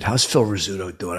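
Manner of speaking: normal talking voice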